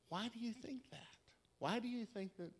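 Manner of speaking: soft, quiet repeated question; quietly asking why she thinks it; gentle question. Retold or imitated. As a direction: ask it softly, with concern. Gently asks dying woman twice.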